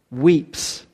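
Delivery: ordinary delivery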